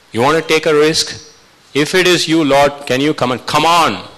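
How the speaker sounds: imitated commanding call, pitched high. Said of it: "That was the command of Christ".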